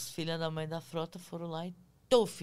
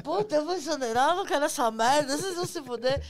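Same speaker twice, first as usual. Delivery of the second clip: higher, louder panicked rant; furious outburst; 7 semitones higher, 8 dB louder, faster than usual. Retold or imitated. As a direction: blow up in fright and anger.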